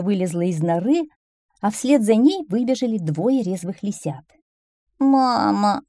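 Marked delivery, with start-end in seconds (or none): 5.0-5.8 put-on higher, louder childish whine; whining fox cub. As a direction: whine for mother like a little cub. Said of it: "захныкали лисят". (хныкающий лисёнок)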